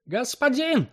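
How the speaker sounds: high, loud angry call; furious shout. Acted. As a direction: yell out in fury. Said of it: "кричал яростно Капома". (яростный крик)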